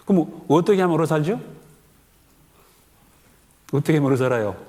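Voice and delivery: normal talking voice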